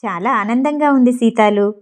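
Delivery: plain reading voice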